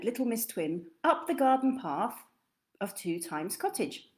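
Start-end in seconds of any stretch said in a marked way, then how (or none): none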